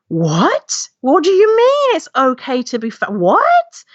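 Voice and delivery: playing her baffled younger self; stunned disbelief. Retells disbelief reading fat acceptance.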